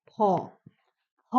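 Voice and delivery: ফ said as a breathy aspirated ph, isolated; aspirated ph sound. Shown as a demonstration. Teacher modelling ফ for the class, aspirated. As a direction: demonstrate ফ with a strong puff of air. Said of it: "সেটা হচ্ছে".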